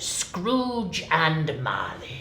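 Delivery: slow, drawn-out dramatic read; theatrical narrator; story narration. A narrator's reading. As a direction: announce the sign. Reads the sign.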